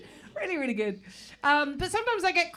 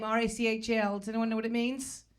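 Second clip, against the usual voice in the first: usual speaking voice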